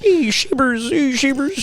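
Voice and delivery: high doting pet-call voice; uncle calling dog. Retold or imitated. Imitating uncle petting Sheba on the deck, high cooing.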